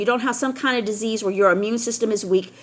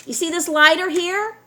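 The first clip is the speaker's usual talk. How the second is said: ordinary talk, nothing marked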